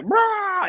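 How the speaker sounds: high squawk imitating a chicken. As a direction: screech like a panicked chicken. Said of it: "this chicken was like".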